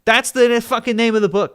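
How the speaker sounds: high and forceful, fed up; exasperated. Real, not acted. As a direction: throw it back angrily at a heckler. Exasperated answer to chatter.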